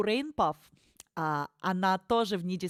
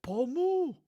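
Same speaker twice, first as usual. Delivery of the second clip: squeaky Pomu call; 7 semitones higher than usual. Acted. Streamer answers chat with a high squeaky Pomu. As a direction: chirp Pomu back like a mascot cry.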